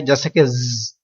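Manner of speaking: ज said quietly with a hissing whistle; teacher showing the whistle to avoid; whistling sound. Shown as a demonstration. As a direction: let ज hiss softly. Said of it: "सीटी की आवाज नहीं आए".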